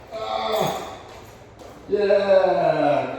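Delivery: ordinary talk, nothing marked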